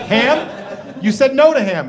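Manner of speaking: played-up indignant protest; acted incredulity. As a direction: question it in baffled outrage. Acted incredulous reply to advice.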